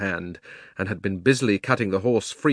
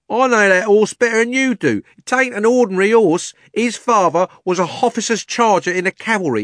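high, loud, urgent warning with dropped and added h's; urgent Cockney cabby; 11 semitones higher, 7 dB louder than usual. Acted. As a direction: push past to save the horse. Cabby voiced.

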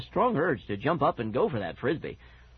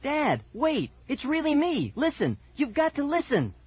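very high, urgent plea; frantic, insistent high voice; desperate pleading; 13 semitones higher, 3 dB louder than usual. A narrator's reading. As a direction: beg to be heard, pitched way up. Dog begs father to listen.

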